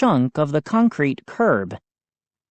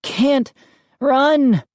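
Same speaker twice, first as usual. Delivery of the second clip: choked out; 9 semitones higher, 3 dB louder than usual. A narrator's reading. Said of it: "I choked out".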